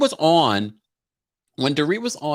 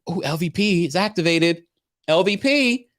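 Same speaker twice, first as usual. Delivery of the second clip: excited; 6 semitones higher than usual. Retold or imitated. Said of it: "I said".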